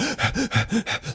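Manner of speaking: fast, audible panicked breaths; re-enacted hyperventilating; panicked gasping. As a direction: gasp in a mock panic. Acting out sudden panic in tent.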